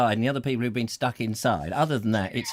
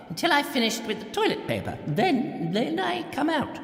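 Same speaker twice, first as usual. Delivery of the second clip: put-on much higher, plummy elderly monarch lament; the Queen; 11 semitones higher than usual. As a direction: play a lonely old queen begging for attention. Queen impression continues.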